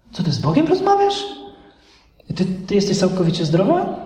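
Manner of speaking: sceptical friend's rising, disbelieving questions; mock alarm, voice leaping up on the question; acted incredulity. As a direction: question it as if doubting her sanity. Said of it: "czerwona lampka i się zapala".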